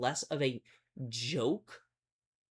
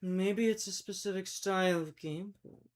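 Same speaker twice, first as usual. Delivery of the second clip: imitating a YouTube narrator voice; mock his old dramatic YouTuber read; clickbait video narrator; 3 semitones higher than usual. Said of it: "get into the YouTuber voice".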